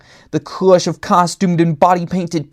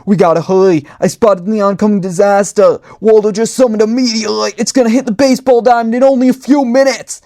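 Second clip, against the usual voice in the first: higher, louder and rushing, building to a frantic yell; panicked scream; 6 semitones higher, 4 dB louder, faster than usual. A narrator's reading. Necktie warns his partner of the meteor, screaming in panic. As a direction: sound the alarm in a panic. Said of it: "Necktie screamed".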